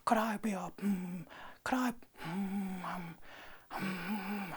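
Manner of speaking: high, soft, dreamy bear murmur; musing like a sleepy honey bear; high breathy Pooh Bear musing to himself; Winnie the Pooh voice. Retold or imitated. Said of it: "I've lost it, I'm losing it".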